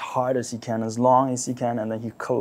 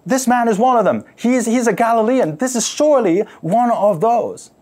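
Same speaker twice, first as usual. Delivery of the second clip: loud, high shout pointing him out; a bystander yelling that Peter is a Galilean; yelled accusation; 8 semitones higher, 8 dB louder than usual. Acted. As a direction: yell it out to the crowd. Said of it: "a person that yelled out".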